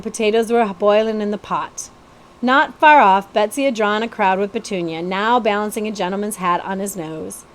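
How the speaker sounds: ordinary delivery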